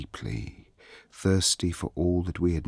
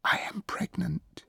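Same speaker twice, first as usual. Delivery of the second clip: high, breathy whisper; 14 semitones higher, 3 dB quieter than usual. A narrator's reading. Princess confesses to the court, whispering. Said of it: "the princess whispered".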